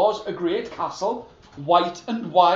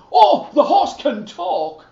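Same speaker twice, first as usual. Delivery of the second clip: higher, louder, excited insistence; eager insisting; 8 semitones higher, 7 dB louder than usual. Acted. The soldier eagerly insists to the king, high and loud. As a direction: convince the king.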